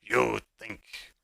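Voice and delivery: slow, loud, menacing dragon; booming dragon voice. Acted. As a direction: boom it out slowly like a huge beast. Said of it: "booms out loud in the tunnel".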